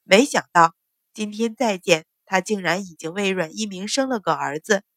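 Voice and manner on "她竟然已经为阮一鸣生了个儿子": ordinary delivery, nothing marked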